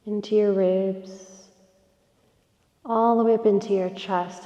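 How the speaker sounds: soft, unhurried yoga nidra guidance with long pauses; guided meditation. Real, not acted. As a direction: gently guide them into rest, breath by breath. Leads a yoga nidra relaxation.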